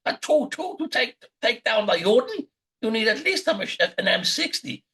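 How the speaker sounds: high-pitched screaming Nazi caricature; Kurt Waldheim Junior. Retold or imitated. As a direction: imitate a ranting radio character, screaming high. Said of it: "would come on screaming and saying".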